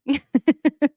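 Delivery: a bright burst of laughter up high; amused laugh. Real, not acted. Laughs about champagne girls.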